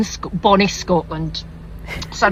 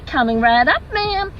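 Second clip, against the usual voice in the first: high, chirpy American barista; eager-to-please American drive-through voice; American accent; 6 semitones higher than usual. Acted. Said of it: "Sorry, forgive the accent".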